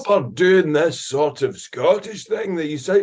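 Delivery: hammed-up, high Shrek-style Scottish accent; Mike Myers. Describing friend's dad, imitating his Shrek-like Scottish accent. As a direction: exaggerate the expat Scottish brogue. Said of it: "sounds like Mike Myers doing Shrek".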